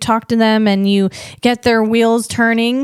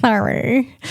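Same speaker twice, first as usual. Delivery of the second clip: quick, giggly self-conscious aside; real amused laughter breaking through; laughing at herself. Real, not acted. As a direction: laugh it off, a little embarrassed.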